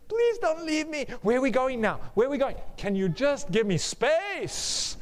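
high, pleading clingy voice; needy clingy partner. Acted. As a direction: beg like a desperate lover. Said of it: "Have you ever dated a thirsty person".

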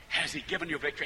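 ordinary talk, nothing marked

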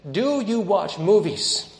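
slow, loud, high-pitched challenge; storyteller voicing a deliberate interrogation; acted probing question. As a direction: draw out the question as a pointed test. Witch's challenge in retold story.

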